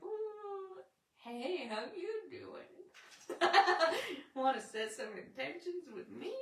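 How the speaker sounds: sugary fake-friendly voice, laughing through it; mock sweet greeting. Snotty wife greeting Troy in a high, giggly sweet voice. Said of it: "I come in snotty and like".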